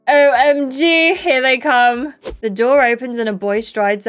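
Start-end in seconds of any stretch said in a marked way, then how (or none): 0.1-2.1 loud, drawn-out squeal of excitement; excited anticipation. Acted. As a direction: draw out each letter, higher and loud. New boys arriving in game.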